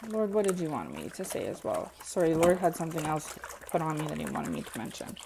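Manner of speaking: talking over dish clatter; doing the dishes while speaking; while washing dishes. Real, not acted. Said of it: "Sorry, I'm doing dishes".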